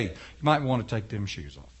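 low, soft, knowing divine voice; deep God voice. Acted. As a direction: speak low like God from the bush. God's voice from the bush.

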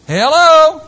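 loud, high rhetorical hello; calling out. Real, not acted. Preacher rousing the congregation, loud high call. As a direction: jolt the room awake.

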